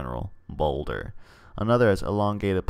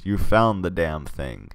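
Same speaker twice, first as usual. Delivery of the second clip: ordinary talk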